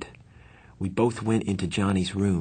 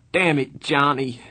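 loud, higher anguished plea; begging cry; 6 semitones higher, 5 dB louder than usual. A narrator's reading. Dally begs dying friend, loud anguished plea. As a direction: beg him, voice raised. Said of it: "he begged".

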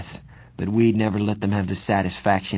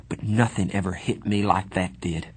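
plain reading voice

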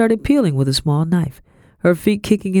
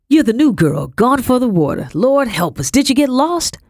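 fussing housekeeper; 9 semitones higher, 3 dB louder than usual. A narrator's reading. Housekeeper fusses over new girl.

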